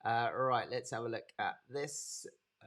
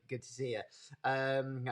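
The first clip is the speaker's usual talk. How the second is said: normal talking voice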